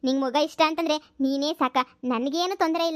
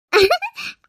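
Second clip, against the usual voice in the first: high squealing laugh; 14 semitones higher, 7 dB louder than usual. Acted. Delighted at the praise.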